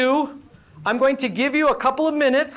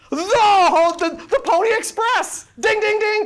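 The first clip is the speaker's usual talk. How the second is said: playful host announcing the right answer; game-show excitement; 8 semitones higher, slower than usual. Pretend. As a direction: ring it out like a quiz-show bell. Game-show style answer reveal.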